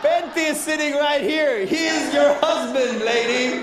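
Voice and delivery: high, laughing mock scold; laughing mock outrage. Real, not acted. Comedian teasing a woman in the crowd, laughing. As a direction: tease her through laughter.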